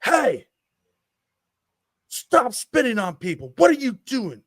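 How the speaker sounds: high, loud angry telling-off. Retold or imitated. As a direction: re-enact a dad yelling at kids to stop. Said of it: "in a very angry tone".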